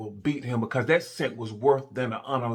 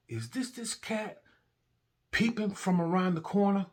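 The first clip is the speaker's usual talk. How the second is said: disbelief; 9 semitones higher, 3 dB quieter than usual. Retold or imitated. Disbelieving reaction to hiding partner.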